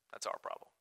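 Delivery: under the breath wry admission; whispered aside. Real, not acted. Wry aside after jerk remark.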